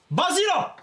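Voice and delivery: high, loud impatient yell; shouting. Real, not acted. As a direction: yell it out, fed up. Urging friend, repeated louder.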